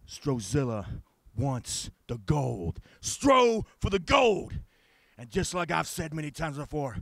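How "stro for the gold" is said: slow high shout. Acted.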